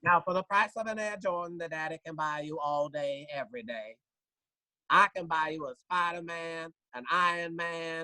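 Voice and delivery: comic exaggerated parent pitch; parent in store. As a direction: sell the cheap option like a parent. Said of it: "he was also subversively telling parents".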